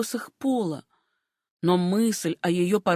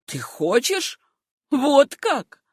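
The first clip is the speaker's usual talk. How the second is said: mocking; 4 semitones higher, much slower than usual. A narrator's reading. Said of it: "насмешливо отозвался мистер Берхэм".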